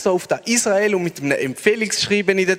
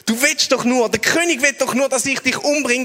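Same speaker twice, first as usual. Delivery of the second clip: high, loud furious rant; outraged king shouting at the sick visitor; king losing it; 8 semitones higher, 3 dB louder than usual. Retold or imitated. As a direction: rant at him, completely beside yourself. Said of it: "rastet völlig aus".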